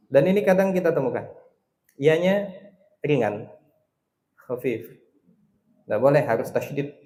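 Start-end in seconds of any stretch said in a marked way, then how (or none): none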